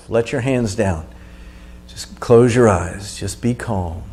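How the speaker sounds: calming. Real, not acted. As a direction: ease them down gently after the effort. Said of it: "calming after exercise".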